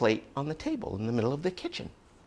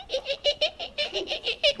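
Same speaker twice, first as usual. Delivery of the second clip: put-on squeaky drawn-out old. A narrator's reading. Storyteller mock-squeaks.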